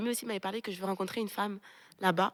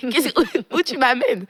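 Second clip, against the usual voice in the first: high, loud baffled protest; bewildered; 5 semitones higher, 10 dB louder, slower than usual. Retold or imitated. Speaker replays her question to God on arriving in the village, bewildered. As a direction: ask God in playful exasperation, pitched up and loud.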